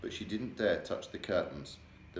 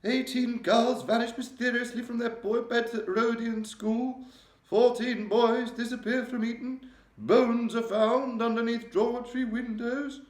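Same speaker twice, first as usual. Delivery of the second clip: high, loud, regal voice, shaken; a distressed queen confiding in her maid; 8 dB louder than usual. A narrator's reading. The Queen telling Mary of her nightmare, regal and upset. Queen reads headlines aloud.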